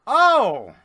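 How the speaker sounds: loud, high-pitched "Oh!"; sudden shout of astonishment; startled outcry. Real, not acted. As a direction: burst out in real surprise at the roll. Game master reacts to dice roll.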